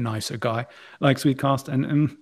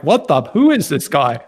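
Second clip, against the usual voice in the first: startled; 3 semitones higher, 8 dB louder than usual. Acted. Retelling a startled reaction.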